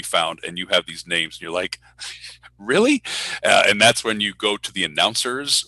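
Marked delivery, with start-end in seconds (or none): 2.6-3.0 high, loud mock disbelief; incredulous. Announcer reacting to baffling names, incredulous. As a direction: squeak out a disbelieving question. Acts out reaction to missing help.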